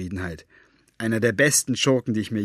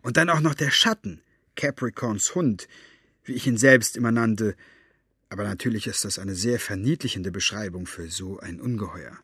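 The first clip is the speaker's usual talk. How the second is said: ordinary delivery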